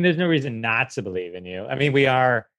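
usual speaking voice

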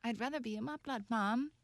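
lightly voiced reluctant child witch; acted whiny child. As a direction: play a soft-voiced kid complaining to a parent. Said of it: "wizards and witches that were like".

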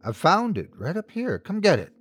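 high coaxing voice; coaxing stranger. A narrator's reading. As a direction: lure the kid in sweetly. Stranger luring the child.

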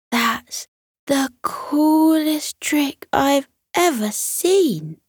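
high, slow and awestruck; amazed. Acted. Kira watching the chameleon change colour, high and amazed. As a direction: gasp it out in wonder.

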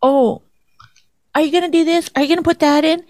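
high-pitched mocking questions; imitating fussy husband. Wife mimicking husband micromanaging her cooking, high questioning voice. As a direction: pitch up each question in mockery. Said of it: "You're like".

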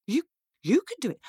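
high, stammered disbelief; quick, swooping astonishment; incredulous girl. Acted. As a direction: repeat it in amazed doubt. Girl astonished at imp's claim.

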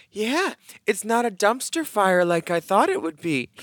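Callie. Retold or imitated. Retoucher judging the photo, imitated sweet Southern accent. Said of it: "she says it with that sweet accent".

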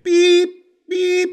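high, loud imitated beeping; shrill reversing beeps; scooter beeps. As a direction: beep like a scooter backing up.

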